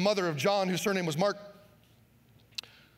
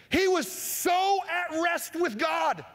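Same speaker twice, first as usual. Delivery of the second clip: higher, slow, drawn-out proclamation; slow emphatic preaching; 9 semitones higher, much slower than usual. Acted. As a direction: lean into every word with awe. Preacher stressing Peter's calm.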